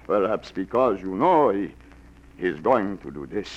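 seductive fake Russian. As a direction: woo her in a hammed-up Russian accent. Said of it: "like the accent, the beard".

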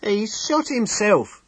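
high, loud, distraught cry; a shaken little man who can barely get it out; horrified innkeeper. Acted. Innkeeper tells Temple the awful news, high and distraught.

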